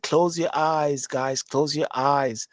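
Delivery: drawn-out close your eyes, taunting; slow teasing quote. Retold or imitated. Mocking a hypnotist telling guys to shut their eyes, slow. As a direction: slowly lull them like a hypnotist. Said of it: "Somebody said".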